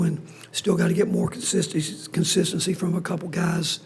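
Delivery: hoarse, half-whispered lost voice. Real, not acted. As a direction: push words through a worn-out voice. Coach speaks with a lost voice.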